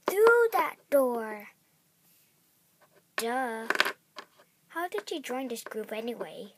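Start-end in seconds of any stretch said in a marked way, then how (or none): none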